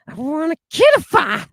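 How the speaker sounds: high mock voice, far from the speaker's own; hammed-up high-pitched quote; mock put-on voice. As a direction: blurt it out high in a silly put-on voice.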